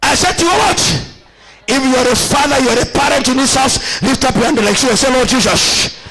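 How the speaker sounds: fervent prayer call over music; preaching. Acted. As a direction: lead the parents in loud prayer. Leads congregation prayer.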